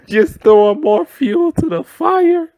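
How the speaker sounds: laughing hard, high and slow; words squeezed through laughter. Real, not acted. Laughing while telling.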